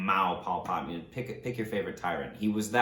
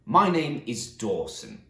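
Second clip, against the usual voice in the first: put-on slow, tentative Australian accent on "Dawson"; slow mock-Australian introduction with a rounded "Dawson"; attempted Australian accent; 4 semitones higher, 6 dB louder, slower than usual. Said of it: "say it in an Australian accent".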